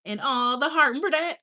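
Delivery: normal talking voice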